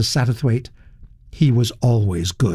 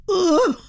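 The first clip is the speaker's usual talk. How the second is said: disgusted ugh. Acted. Duchess disgust noise.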